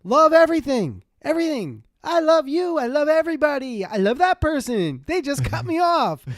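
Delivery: high, beaming, gushing about love; blissful driver. Retold or imitated. Driver telling passenger he loves everyone, blissfully high. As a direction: gush like you adore the whole world. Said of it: "He's like".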